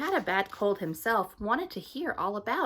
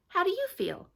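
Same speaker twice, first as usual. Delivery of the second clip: much higher eager question; Hattie's voice; 12 semitones higher than usual. Acted. Hattie asking the stranger back, high and eager. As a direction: ask it back brightly, high.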